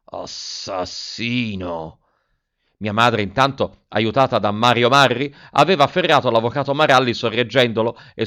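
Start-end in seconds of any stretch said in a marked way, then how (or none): 0.0-2.0 low, soft, very slow growl. A narrator's reading. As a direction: snarl the accusation through the teeth.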